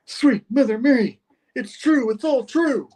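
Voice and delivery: very high theatrical exclamation; hammed-up awe. Cameraman seeing his theory proven, high awestruck outcry. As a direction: cry out in mock amazement.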